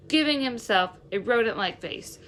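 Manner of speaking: plain reading voice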